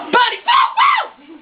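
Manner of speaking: fairy losing it at the bunny; furious shriek. Acted. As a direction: scream his name in outrage.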